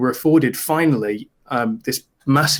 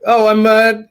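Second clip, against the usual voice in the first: high, loud, silly salesman voice; put-on goofy voice, pitched up and drawn out; stupid character voice; 10 semitones higher, 5 dB louder, slower than usual. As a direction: announce the name in a daft raised voice, with big pauses. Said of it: "a stupid voice comes out".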